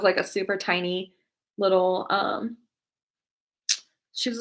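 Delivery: normal talking voice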